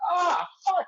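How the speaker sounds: sudden high frustrated outburst; a jump in pitch as things go wrong; exasperated curse. Retold or imitated. Retelling her frustration at the ring road, a high exasperated curse. Bike problem, frustrated exclamation.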